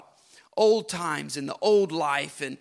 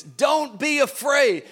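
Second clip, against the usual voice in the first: raised, slow, insistent don't be afraid; fervent preaching; 9 semitones higher, 6 dB louder, slower than usual. Acted. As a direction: proclaim it to the crowd. Preacher repeats with fervor.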